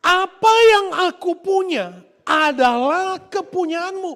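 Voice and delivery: slow and loud, every word weighed; loud declaration. Acted. Father declaring to his son, slow and loud. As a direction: proclaim it like the father.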